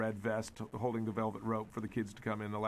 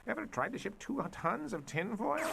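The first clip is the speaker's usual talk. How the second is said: grumbling villain; 6 semitones higher, 5 dB quieter than usual. Acted. Doctor complains in background.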